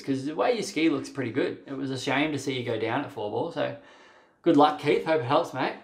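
normal talking voice

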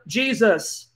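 usual speaking voice

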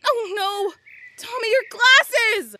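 loud, high cry of dismay; alarmed cry. Acted. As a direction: shout in alarm at what just broke. Alarm at broken glasses.

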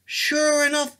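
plain reading voice

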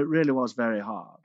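ordinary talk

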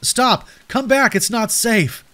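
high-pitched, alarmed calling out; urgent call. Acted. Narrator warns Howie fleeing across the lawn, urgent and high. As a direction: call a runaway child back in alarm. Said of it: "I hissed".

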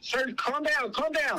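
high, alarmed voice; urgent calming plea. Real, not acted. Officer calming screaming caller, urgent and alarmed. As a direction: try to get through to someone panicking.

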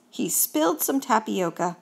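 ordinary delivery

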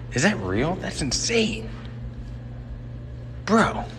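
ordinary delivery

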